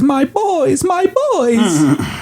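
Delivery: high, put-on cat character voice; Sassy the cat. Cat running towards her dog friends, high hammed-up voice. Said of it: "she says".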